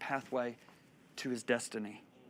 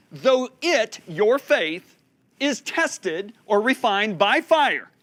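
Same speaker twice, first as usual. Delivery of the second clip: loud, raised, weighty scripture reading; full-force preaching; 7 semitones higher, 8 dB louder, slower than usual. Real, not acted. Preacher reading on trials refining faith, full-force preaching. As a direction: proclaim it, leaning on the key words.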